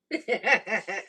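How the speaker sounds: short, rapid giggling laugh; real chuckle. Real, not acted. Cook amused by side exchange, quick laugh.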